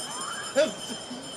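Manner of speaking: airless high laugh; high breathy laugh. Real, not acted. Streamer laughs at Trump's carbon jab, airless. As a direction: let a silent high giggle out.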